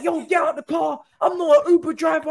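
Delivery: hammed-up panicked driver's shout; mock screaming. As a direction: yell it high, as if ordering someone out. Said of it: "she's screaming and she's like".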